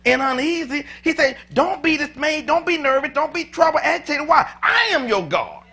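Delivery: loud, high preaching as God speaking; rising preacher's shout; full-force preaching. Acted. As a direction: preach it hard, God commanding the flock. Preaching God's words at full force.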